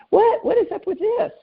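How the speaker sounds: high-pitched incredulous complaint; mock indignation. Acting out her mock complaint.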